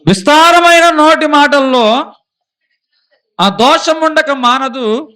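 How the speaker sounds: loud, high, ringing Bible reading; scripture proclaimed. Acted. Preacher reading a proverb to the congregation, booming. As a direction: proclaim the verse to the whole church. Reads scripture at full force.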